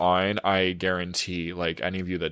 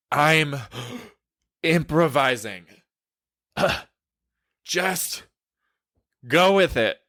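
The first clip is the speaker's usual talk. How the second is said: mock breathless guy; 7 semitones higher than usual. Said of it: "he wraps it ... starts doing sit-ups".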